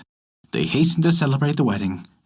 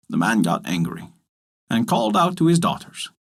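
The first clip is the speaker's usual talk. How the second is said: usual speaking voice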